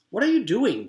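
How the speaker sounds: loud, high furious demand; angry demand. A narrator's reading. Girl confronts the teacher painting the blacktop, angry demand. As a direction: confront him hard. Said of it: "Joy demanded".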